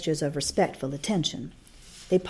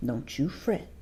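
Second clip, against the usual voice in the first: soft, hushed reassurance; reassuring whisper; 4 semitones lower, 6 dB quieter than usual. A narrator's reading. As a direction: Calpurnia calming the child at her side. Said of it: "Calpurnia whispered to me".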